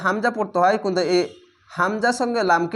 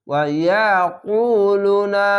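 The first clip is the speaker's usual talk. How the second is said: ওয়া ইয়াকুলুনা with long, held vowels; drawn-out recitation; 3 dB louder, much slower than usual. Shown as a demonstration. Teacher reciting the whole word for learners, drawn out. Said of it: "এখানেও পেশের পাশে অসাকিন এক আলিফ টেনে পড়বেন".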